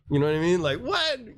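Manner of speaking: usual speaking voice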